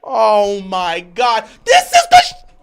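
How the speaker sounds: drawn-out groan rising to a scream; exasperated outburst. Real, not acted. Outburst at a bad play.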